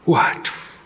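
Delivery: hushed gasp of dismay; breathy waduh. A narrator's reading. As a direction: whisper it in dismay.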